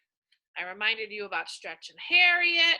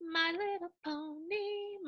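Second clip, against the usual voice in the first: sing-song; 7 semitones higher, 10 dB quieter than usual. Shown as a demonstration.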